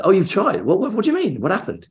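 high-pitched, disbelieving questions; incredulous. Retold or imitated. Fan questions colleagues who say they've tried, incredulous. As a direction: pounce on the news in disbelief.

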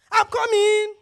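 loud, high, exasperated shout back; irritated yell. Shown as a demonstration. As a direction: yell it impatiently at your husband. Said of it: "your tone is so important".

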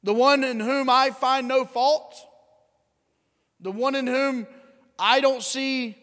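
a governor who can't believe what he's hearing; incredulous Pilate. Retold or imitated. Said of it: "he answered them saying".

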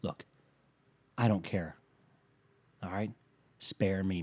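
normal talking voice

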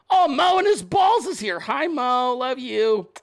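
high-pitched, gushing hello; excited greeting. Real, not acted. Host greets Mo arriving at the show, excited greeting. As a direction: squeal a fond welcome to a friend.

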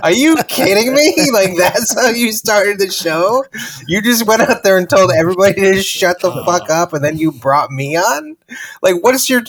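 high, disbelieving rant; can't believe what just happened; incredulous. Retold or imitated. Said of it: "And I was like".

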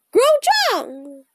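short bird-call croak; raven croak. A narrator's reading. Annie the raven calling to Jack, croaking. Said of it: "croaked Annie".

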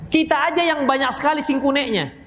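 usual speaking voice

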